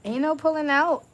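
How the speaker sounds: high, loud laughing yell; playful shout. Acted. Reacting to a card to the viewers: loud laughing yell.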